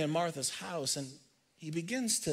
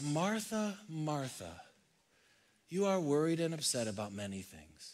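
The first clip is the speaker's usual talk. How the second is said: tender Jesus; 9 dB quieter than usual. Acted. Jesus gently answering busy Martha, softly. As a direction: say the name like a caring friend. Jesus' response quoted again.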